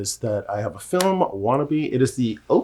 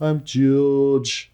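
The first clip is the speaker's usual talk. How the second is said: louder, drawled Liverpudlian impression; Liverpool drawl; 4 semitones higher, 5 dB louder than usual. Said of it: "the way that they talked".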